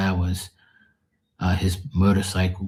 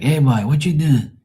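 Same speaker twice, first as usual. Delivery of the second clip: put-on higher, louder dad calling his boy; imitating dad; 7 semitones higher, 5 dB louder than usual. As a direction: mimic a dad hollering a question. Acts out dad calling him.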